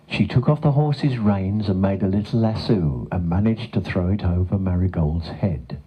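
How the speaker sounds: usual speaking voice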